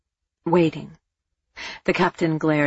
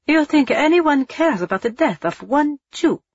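high, loud incredulous scoff; 10 semitones higher, 4 dB louder than usual. Acted. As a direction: sneer in disbelief.

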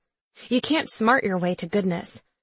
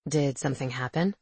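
male character voice; 6 semitones lower, 4 dB quieter than usual. A narrator's reading.

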